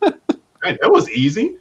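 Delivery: high, laughing mock surprise; laughing disbelief. Real, not acted. Fan laughing at how easily she agreed, high amused surprise. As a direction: laugh at how easy it was.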